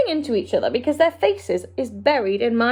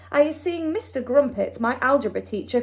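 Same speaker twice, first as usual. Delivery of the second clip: ordinary delivery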